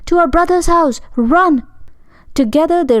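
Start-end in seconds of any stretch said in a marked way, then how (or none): 0.1-1.6 panicked scream. A narrator's reading. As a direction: scream while running for your life. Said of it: "screamed and ran".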